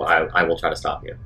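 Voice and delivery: ordinary delivery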